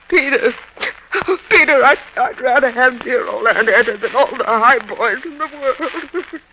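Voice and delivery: loud plea that slows at the end; tearful outburst. Acted. The wife cries out to her husband that she loves her aunt. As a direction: burst out, then let it fade.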